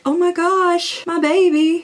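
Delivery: high, slow, worried cry; distressed wail. Retold or imitated. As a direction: plead over the sick pet. Distressed over sick dog.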